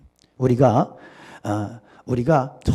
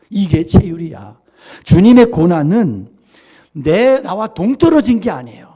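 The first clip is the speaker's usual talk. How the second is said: ordinary talk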